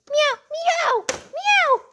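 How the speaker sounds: squeaky high kitten meows; high cat meow. Pretend. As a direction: meow like a tiny scared cat. Stolen cat cries.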